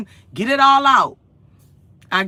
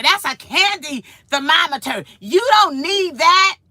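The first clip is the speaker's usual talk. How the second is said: higher, mock-angry scolding; pitched-up mock yell of the husband; imitating husband yelling; 8 semitones higher, 3 dB louder than usual. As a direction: ham up the husband's telling-off. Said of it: "He started screaming at me".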